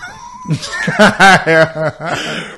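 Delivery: loud laugh up high. Real, not acted. Man laughing at his own dumb parking, loud high laugh. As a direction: crack up loudly.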